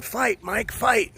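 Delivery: high-pitched call urging a friend on; retold urging cry. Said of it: "I start going".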